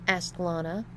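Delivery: ordinary delivery, nothing marked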